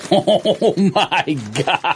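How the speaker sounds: slow, laughing oh my God; laughing disbelief. Real, not acted. Reacting to the chips' smell, laughing slowly. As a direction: drag it out through a laugh.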